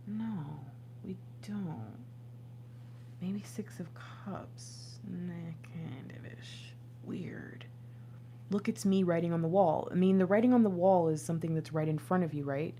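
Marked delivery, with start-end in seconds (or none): 0.1-7.6 soft, slow whispered musing; whispered to herself. Real, not acted. Reader puzzling over cards alone, whispered musing. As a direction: think aloud under your breath.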